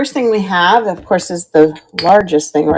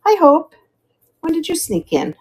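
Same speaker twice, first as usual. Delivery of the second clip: surprised; 11 semitones higher than usual. Real, not acted. Surprised greeting to viewer.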